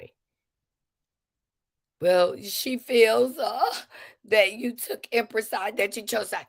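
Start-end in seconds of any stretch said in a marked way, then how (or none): 2.0-6.5 high, slow, put-on voice for Wanda's message; lightly voicing Wanda, drawn out and high; mock Wanda voice. Speaker mimicking Wanda retelling her complaint, high and slow.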